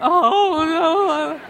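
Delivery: high, wailing mother's "oh no"; wife's dismay. Retold or imitated. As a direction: wail it like a heartbroken mom. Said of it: "Sherry went".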